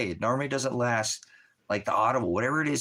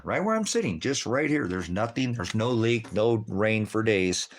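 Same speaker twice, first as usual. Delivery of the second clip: ordinary talk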